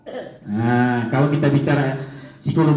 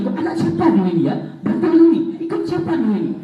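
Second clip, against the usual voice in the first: mock angry parent; 5 semitones higher, much faster than usual. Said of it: "Nanya lagi".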